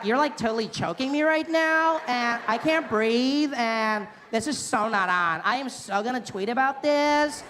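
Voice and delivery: high, whiny valley girl mock; mock a dramatic teen girl. Teen girl complaining she is being choked, whiny.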